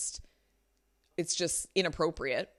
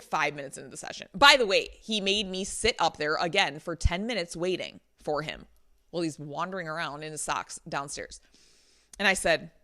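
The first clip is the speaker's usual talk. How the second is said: normal talking voice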